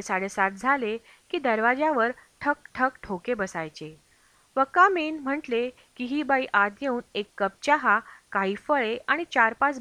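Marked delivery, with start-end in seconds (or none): 4.7-5.2 loud call to come in; loud "come in" to a knock. Retold or imitated. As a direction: call through the door, raised and high. Maid knocking, called to enter.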